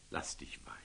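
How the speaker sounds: a soft, breathless whisper of advice; whispered counsel. Acted. Sultan quietly counsels the young Templar, whispering. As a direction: whisper it like a secret warning.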